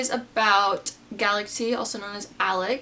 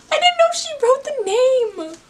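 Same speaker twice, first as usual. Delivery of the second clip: much higher and louder, slowed in disbelief; thrilled high-pitched realization; excited squeal; 8 dB louder, slower than usual. Real, not acted. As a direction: squeal it out as it dawns on you. Excited discovery of signed copy.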